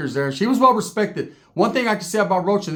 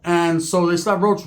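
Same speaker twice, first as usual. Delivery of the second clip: usual speaking voice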